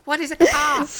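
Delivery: high-pitched, loud puzzled questioning; baffled foreigner. Acted. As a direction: play someone mystified by a strange word. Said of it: "They're like".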